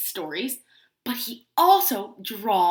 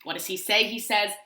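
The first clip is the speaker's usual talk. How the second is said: usual speaking voice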